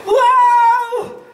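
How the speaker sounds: loud delighted exclamation; excited wow. Acted. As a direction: gasp with delight. Sudden idea exclamation.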